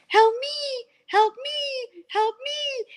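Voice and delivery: high, drawn-out peacock cry imitation; screaming peacock. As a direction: wail it like a peacock on the roof. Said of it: "he thought it was us screaming".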